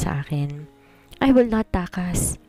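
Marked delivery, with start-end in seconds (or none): 1.2-2.4 slow, higher, teary promise; tearful. A narrator's reading. Astrid tearfully promising Apollo she won't run, slow and teary. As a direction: say it holding back tears, slowly. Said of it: "habang naiiyak".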